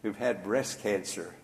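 ordinary talk, nothing marked

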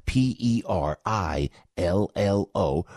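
each letter "P E R I L L O" said slowly and separately; spelled slowly. Shown as a demonstration. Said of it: "spelled out".

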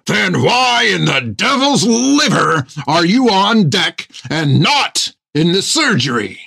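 slow, high, loud bellow. A narrator's reading. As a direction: bellow it right in his face. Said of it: "bellowed in Thigham's face so loudly".

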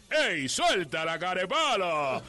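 loud, slow announcer shout; imitating the DJ. DJ shouting at whoever played the records, loud. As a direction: stretch out the call. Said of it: "decía".

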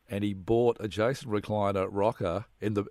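ordinary talk, nothing marked

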